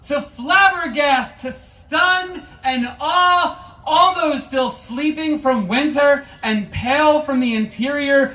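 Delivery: high, loud ecstatic shout. Acted. Poet to audience, waking everyone with a joyful shout. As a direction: cry out to wake the sleepers. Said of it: "this is supposed to be loud".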